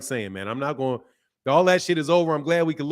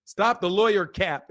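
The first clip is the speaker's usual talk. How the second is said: ordinary delivery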